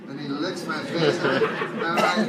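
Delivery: normal talking voice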